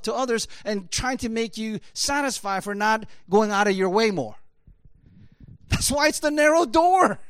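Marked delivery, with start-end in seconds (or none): 5.7-7.2 loud, high, forceful preaching; emphatic preaching cry. Real, not acted. Preacher warning folks against the law of fairness, emphatic cry. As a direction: drive it home at raised volume and pitch. Preacher drives his point home.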